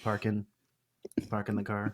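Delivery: "Parking" as "parkin'", quietly; soft "Parking" without the final G; dropped G. Shown as a demonstration. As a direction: end "Parking" on an N. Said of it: "Or dropping the G".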